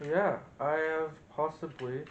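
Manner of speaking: ordinary delivery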